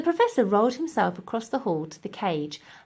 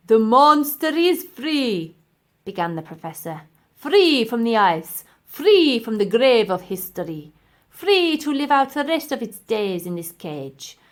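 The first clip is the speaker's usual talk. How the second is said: professor voice; 3 semitones higher, 6 dB louder than usual. Acted. Villain professor speech.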